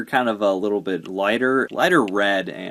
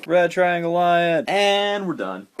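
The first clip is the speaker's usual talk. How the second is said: high, punchy announcer-like rundown; rapid-fire box read; 6 semitones higher, 3 dB louder than usual. Acted. Quick box review of a toy for viewers, hyped read-out. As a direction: call out each item high and punchy.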